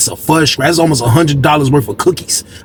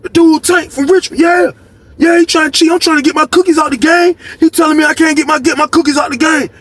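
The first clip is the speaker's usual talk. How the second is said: pitched-up upset rant; upset Lee; 12 semitones higher than usual. Retold or imitated. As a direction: complain like Lee being cheated. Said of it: "He was like".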